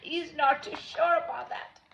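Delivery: ordinary delivery, nothing marked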